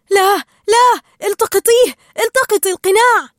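loud, high, urgent yelling; panicked shout. A narrator's reading. Marlin sees the mask sinking, yells urgently to Dory. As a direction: shout desperate orders. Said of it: "صاح مارلين".

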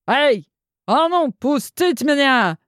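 high, slow bellowing chef voice; roaring chef. Acted. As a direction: roar it like an angry cook. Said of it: "проревел заворотенный шеф-повар".

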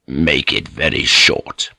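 low, slow, stern lord; cold command. A narrator's reading. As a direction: grant it grudgingly, low and slow. Lord grants a curt permission.

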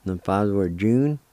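normal talking voice

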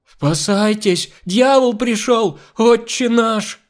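slow, high, loud wailing alarm; yelling in panic. A narrator's reading. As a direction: scream to warn everyone, stretching the words. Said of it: "Нюша орала за двоих". (панический протяжный крик)

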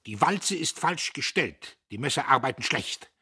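sudden indignant shout; flaring up. A narrator's reading. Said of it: "Diederich fuhr auf".

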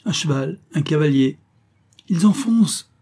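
high cry rising in alarm. A narrator's reading. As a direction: build to a loud, high shout. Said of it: "cria à son tour Olivain".

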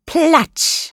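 very high exclaimed splash; splash sound word. A narrator's reading. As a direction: shoot the pitch up on the splash. Narrator imitates a splash. (sehr hoch ausgerufener Platscher)

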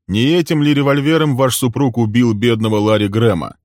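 forceful interrogation; pointed accusation. Acted. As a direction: confront her with the charge. Man accuses woman's husband.

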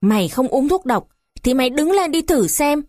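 mild narrated yell, pitched high; restrained angry command; shouted order. A narrator's reading. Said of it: "Một người quát to".

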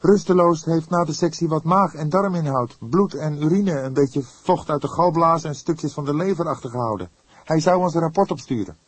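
the junior partner admitting the autopsy got to him; young detective voice. Acted.

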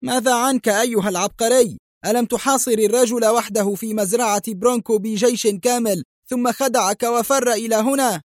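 police chief mocking his rival, high sarcastic cries; mocking outcry. A narrator's reading. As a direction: jeer back at the genius. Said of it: "هتف جوزيه".

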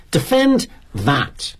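slow, drawn-out angry challenge; indignant challenge. Acted. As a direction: throw it down slowly as a challenge. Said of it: "he sputters like a boiling tuber".

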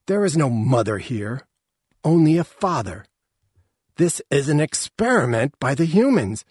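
loud, indignant pronouncement; angry matriarch. A narrator's reading. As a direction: denounce it loudly and angrily. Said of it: "never smelled anyone so angry".

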